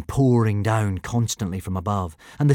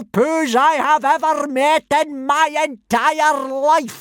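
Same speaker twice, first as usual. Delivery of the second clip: slow, much higher, louder tirade; furious howl; 6 dB louder, slower than usual. Acted. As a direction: build to a raging howl. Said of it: "howled Gobber".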